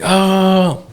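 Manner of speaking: mild mock scream, pitched well up; a high "oh" imitating a scream; retold scream. Driver retelling the moment before hitting the wall, mock scream. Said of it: "I started screaming".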